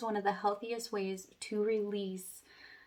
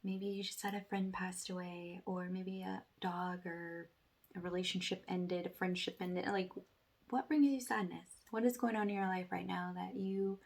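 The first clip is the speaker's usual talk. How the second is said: guided meditation; 6 dB quieter than usual. Acted. Meditation guide asking viewers to release sadness, soft and hushed.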